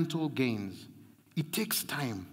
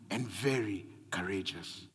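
whispered; 8 semitones lower, 7 dB quieter than usual. Acted. Pastor quoting scripture intimately.

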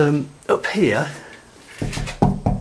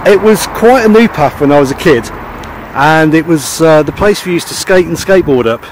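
loud outdoor voice over gusts; shouting over wind; 3 semitones higher, 8 dB louder than usual. Real, not acted. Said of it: "hope you can hear me through the wind and the noise".

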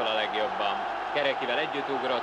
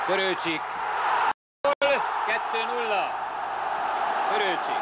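goal shout; 9 semitones higher than usual. Real, not acted. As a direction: shout the scorer's name. Goal scored.

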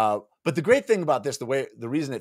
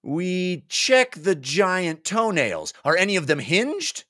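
higher, slow, pointed question; higher-voiced player making a point; eager player; 8 semitones higher, slower than usual. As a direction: raise a finger and press the point slowly. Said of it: "Chambers sat forward with finger raised".

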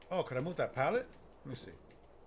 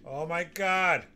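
loud, slow, drawn-out exclamation; real relief and exasperation; exasperated cry; 3 semitones higher, 8 dB louder, much slower than usual. Real, not acted. Overwhelmed after scary section.

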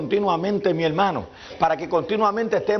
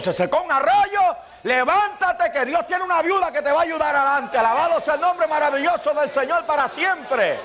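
preaching shout; 9 semitones higher than usual. Acted. Preacher commanding the congregation to rise, shouting. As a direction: shout it out to rouse the church.

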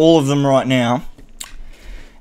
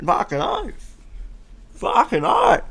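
high sing-song; 13 semitones higher, 7 dB quieter than usual. Real, not acted.

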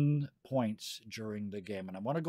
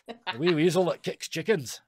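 playful Scottish brogue, a bit louder; Scottish accent; 6 semitones higher, 8 dB louder than usual. Pretend. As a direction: give it a cheeky Scottish lilt. GM playing up Scottish.

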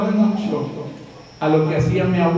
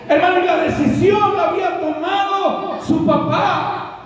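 fervent preaching; 7 semitones higher than usual. Acted. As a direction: lift the congregation with conviction. Sermon climax.

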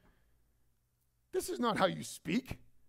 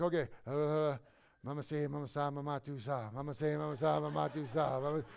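lightly mocking babble of fake tongues, lower; 5 semitones lower than usual. Preacher mocking a taught tongues class with babbled chant. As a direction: parody someone faking tongues in a chant. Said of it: "all of a sudden they're like".

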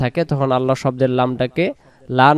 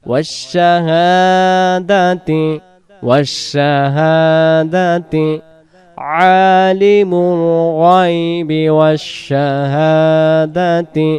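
slow, drawn-out melodic recitation; Quran recitation; 3 semitones higher, much slower than usual. Acted. Teacher reciting the verse slowly for students to repeat. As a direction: chant each phrase slowly, stretching the vowels.

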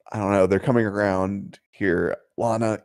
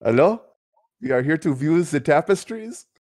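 put-on high-pitched, botched Scottish accent; bad Scottish accent; 7 semitones higher than usual. Player greeting to view tapestries, off-target Scottish accent. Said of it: "What accent was that?".

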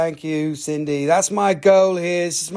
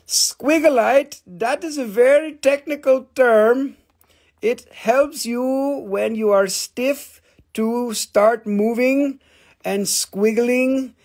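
slow, drawn-out sales pitch; slow playful showman; mock announcer; 5 semitones higher, slower than usual. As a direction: stretch it like an infomercial. Mock-technical slow delivery.

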